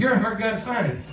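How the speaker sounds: high-pitched caricature of a singer on the phone; comic high-voiced impersonation. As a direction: exaggerate his voice, way up high. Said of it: "I do not talk like that".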